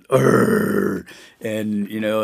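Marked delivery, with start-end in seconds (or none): 0.1-1.1 grunt. Retold or imitated. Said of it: "the greater power said".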